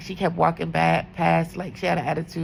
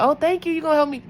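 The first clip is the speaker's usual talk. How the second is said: bright, high, louder mock gratitude; fake-sweet thanks; 10 semitones higher, 4 dB louder, faster than usual. Nurse answering the sassy aide with exaggerated, high, louder thanks. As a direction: pile on the fake cheer.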